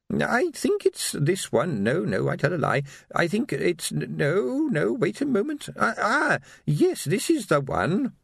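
high, hesitant querulous voice; dithering old lady. Acted. Old shopkeeper hunting through her keyring, dithering.

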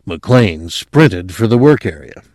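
plain reading voice

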